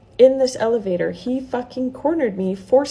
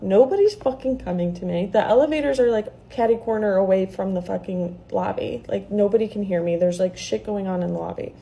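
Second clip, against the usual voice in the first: ordinary talk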